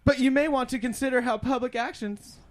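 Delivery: stumbling stoned read; reading while high; stoner. Real, not acted. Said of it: "I'm really high right now".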